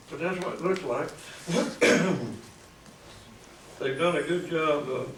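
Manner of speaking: normal talking voice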